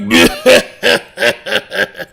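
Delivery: hearty chuckle; real laugh. Real, not acted. Demon laughs at the summoner's joke.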